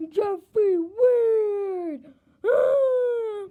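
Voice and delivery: loud, slow wailing yell; crying yell. Acted. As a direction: bawl the name out in a tantrum. Cries out in childish outburst.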